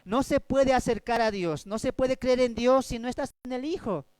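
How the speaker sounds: normal talking voice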